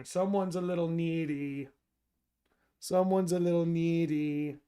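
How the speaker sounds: slow, soft doting tease; soft teasing. Real, not acted. Streamer teasing his needy dog, soft doting tone. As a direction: coo it fondly.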